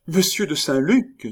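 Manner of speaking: high, loud exclamation of recognition; surprised cry. A narrator's reading. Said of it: "s'écria Remy".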